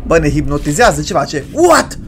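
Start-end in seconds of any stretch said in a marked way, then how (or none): 1.5-2.0 high, loud yelp of disbelief; startled. Real, not acted. Player startled by the cat, high loud exclamation. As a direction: blurt it out in shock.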